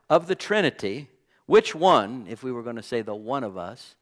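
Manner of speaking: usual speaking voice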